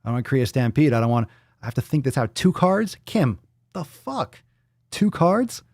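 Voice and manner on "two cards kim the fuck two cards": high-pitched mock disbelief; incredulous. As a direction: question it as if appalled, pitch leaping up. Mock outrage at two cards.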